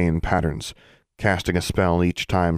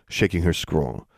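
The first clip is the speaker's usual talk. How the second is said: ordinary delivery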